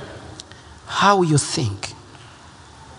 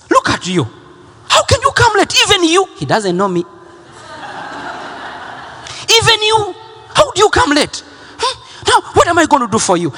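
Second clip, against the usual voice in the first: high, indignant, disbelieving reprimand; scolding gate agent; 13 semitones higher than usual. Retold or imitated. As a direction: play the fed-up airline clerk. Angry gate agent voiced in story.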